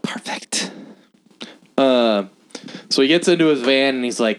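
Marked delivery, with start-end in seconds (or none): 0.0-0.7 soft breathy whisper of perfect; whispered echo of Perfect. Shown as a demonstration. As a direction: whisper it to yourself. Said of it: "is perfect".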